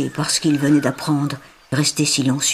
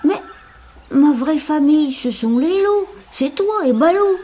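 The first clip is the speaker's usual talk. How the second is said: put-on high, pleading child voice; a child protesting to his mentor; young boy Mowgli; 11 semitones higher than usual.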